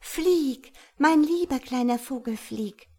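joyful call. Acted. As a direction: send the little bird off with delight. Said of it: "küsste es und rief".

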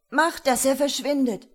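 high, loud desperate plea; desperate sob. A narrator's reading. Terrified boy begging his father to banish a ghost, desperate. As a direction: plead loudly, close to tears. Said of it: "schluchzte er verzweifelt".

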